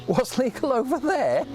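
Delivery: high-pitched mock questioner; mimicked chatty classmate. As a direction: put on a high, clueless questioning voice.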